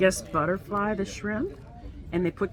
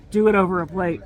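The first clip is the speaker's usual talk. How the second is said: ordinary talk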